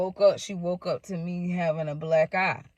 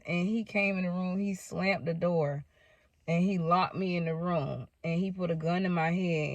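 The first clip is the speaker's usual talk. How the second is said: ordinary talk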